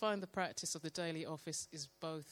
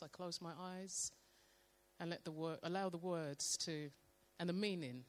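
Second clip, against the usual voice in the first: ordinary talk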